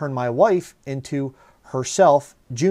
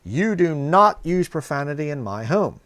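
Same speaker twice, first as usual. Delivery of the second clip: re-enacting a firm, raised-voice order; higher, emphatic scolding, each word driven home; retold yell; 4 semitones higher, 4 dB louder than usual. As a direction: lay down the law, retold. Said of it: "I yelled and I said".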